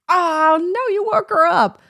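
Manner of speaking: high, loud exasperated yell; retold yell. Mom yelling at her son for waking his sister, high and loud. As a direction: snap at the toddler who woke his sister. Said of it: "And I kinda yelled".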